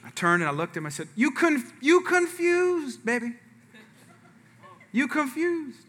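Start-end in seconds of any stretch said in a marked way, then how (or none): 1.1-5.8 put-on slow and soft, gently telling your boy he's confused; tender to son. A father gently correcting his seven-year-old, slow and tender. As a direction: ease it out like comforting a child.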